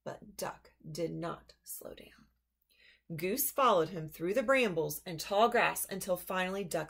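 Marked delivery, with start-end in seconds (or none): 0.0-2.3 soft, slow whisper; whispered aside. A narrator's reading. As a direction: hush the punchline. Whispered narration.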